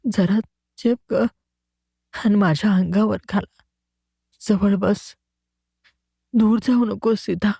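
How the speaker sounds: high, pleading, worn-out voice of a dying man; feverish delirious mumbling. A narrator's reading. Sick man mumbling to his absent wife, high and pleading. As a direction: plead with Sita through fever, exhausted. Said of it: "तो बडबडत चालू लागला".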